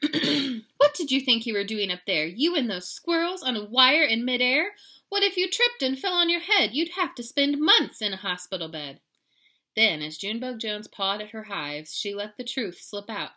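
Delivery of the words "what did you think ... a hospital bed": loud, raised-pitch scolding; scolding mother. A narrator's reading. Mama to kitty in his room, scolding loudly. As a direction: lecture in worried exasperation.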